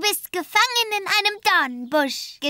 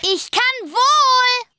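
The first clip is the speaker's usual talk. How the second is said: defiant shout; 6 semitones higher, 3 dB louder, slower than usual. Acted. Childish quarrel.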